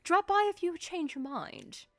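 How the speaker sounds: lightly British, airy farewell; see them off in a light British accent; Victor's British voice. Acted. Uncle Victor dismissing player, light British accent. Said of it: "So he says".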